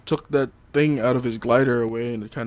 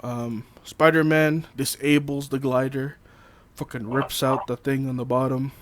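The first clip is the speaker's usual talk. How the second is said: normal talking voice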